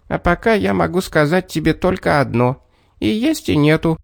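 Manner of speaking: high, teasing little man voice; riddling little man. A narrator's reading.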